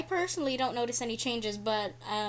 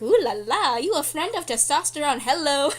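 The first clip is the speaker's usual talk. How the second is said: louder sing-song teasing over a new jawline; flirty excited exclamation; 3 semitones higher, 7 dB louder than usual. Retold or imitated. Texting friend about new jawline, loud sing-song tease. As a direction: hype yourself up like flirting with the mirror. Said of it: "I was just like".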